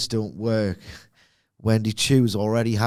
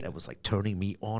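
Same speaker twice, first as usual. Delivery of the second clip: ordinary talk, nothing marked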